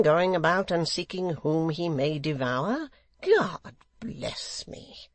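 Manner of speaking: loud, slow, drawn-out indignation; affronted secretary. A narrator's reading. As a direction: act scandalised, swooping on key words. Gashford feigns shock at widow.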